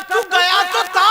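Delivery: high, loud disbelieving cry; mother's outburst. Acted. Mother crying out in disbelief at her son. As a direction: throw his words back at him in disbelief.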